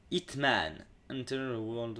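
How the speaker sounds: English game title in a French accent, low and soft. Real, not acted. Reading out a mouse pad's title, French-accented English. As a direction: read the English softly through a French accent. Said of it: "mon accent anglais magnifique hein".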